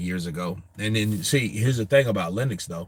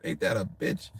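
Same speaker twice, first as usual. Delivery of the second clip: usual speaking voice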